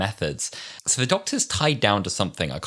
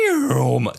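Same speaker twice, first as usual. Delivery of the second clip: higher whooshing "nyoom"; imitated zooming noise; 5 semitones higher, 4 dB louder than usual. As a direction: zoom into the cliffhanger. Imitating sound into cliffhanger.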